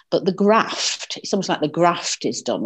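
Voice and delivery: broad northern English vowel in "graft", short a; northern accent. Real, not acted. A northern woman on a political chat show saying "graft" the Northern English way. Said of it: "That's my northern accent coming out there".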